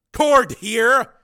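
high, loud phone shout; barked shout. A narrator's reading. Commander calling launch control, urgent shout. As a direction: bellow your name into the phone.

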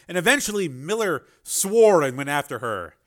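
ordinary talk, nothing marked

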